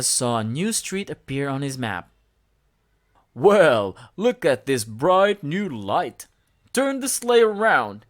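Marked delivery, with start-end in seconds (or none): 3.3-8.1 louder, slow jolly voice; Santa voice. A narrator's reading. Lightly voiced Santa calling to his elf, louder. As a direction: boom it out like Santa. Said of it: "he told his elf".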